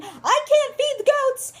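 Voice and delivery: put-on high, drawn-out kid's scream; hammed-up high-pitched wail; mock yelling. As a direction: yell it like a frantic seven-year-old. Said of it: "So I'm alternating between".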